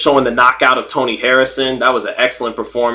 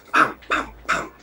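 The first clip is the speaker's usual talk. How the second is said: soft mimed punch thuds; imitated punch sounds; 9 dB quieter than usual. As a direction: puff out quiet, toneless punch impacts. Imitating his uppercuts landing.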